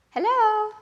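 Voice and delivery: high squawky parrot hello. Acted. Teacher shows class how the parrot talks, in a high parrot voice. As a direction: chirp it like a parrot.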